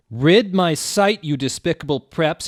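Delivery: shouted. A narrator's reading. Snaketail shouting at Ebony and Vampire. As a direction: bellow it at the intruders. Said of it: "he shouted".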